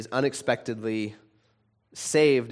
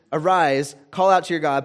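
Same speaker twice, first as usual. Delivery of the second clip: loud, higher urgent call; captain's command; 5 semitones higher, 7 dB louder than usual. Acted. Captain urging Jonah to call on God, loud and higher. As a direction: rouse him with the captain's loud order. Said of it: "but there's".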